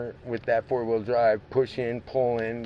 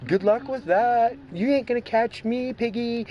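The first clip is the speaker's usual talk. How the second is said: cocky high-pitched jeer; taunting the police; 12 semitones higher, 4 dB louder than usual. Acted. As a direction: crow it at the cop chasing you. Playing a driver taunting police.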